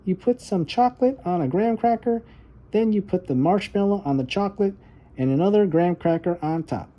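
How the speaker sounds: put-on high, eager child's voice; little girl voice. Little Violet telling her brother about the dark, high and earnest. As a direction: play the small sister talking herself brave.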